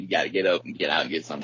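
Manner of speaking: ordinary delivery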